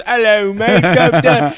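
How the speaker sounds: loud, high-pitched mock British; hammed-up British greeting; mock British accent. Imitating a comedian who mocks British people, exaggerated British accent. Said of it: "makes fun of British people, and he, and he goes".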